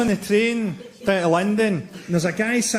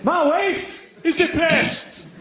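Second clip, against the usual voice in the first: angry heckler; 10 semitones higher, much slower than usual. Retold or imitated. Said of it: "A guy get really fucking angry".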